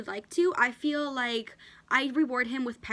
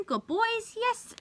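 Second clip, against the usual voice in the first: much higher, excited praise for the dog; praising the dog; 12 semitones higher, much slower than usual. Real, not acted. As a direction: cheer him on in a high voice. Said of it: "I'm gonna reward him".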